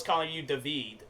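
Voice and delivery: David said as duh-VEED; deliberate mispronunciation. Retold or imitated. Showing his friend how a wrong name sounds, mock mispronunciation. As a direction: stress the second syllable of David, with a long "ee". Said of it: "that don't sound right".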